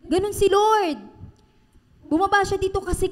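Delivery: full-force Filipino preacher; fervent preaching. Acted. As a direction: lift the congregation with rising fervor. Preaching at rising intensity.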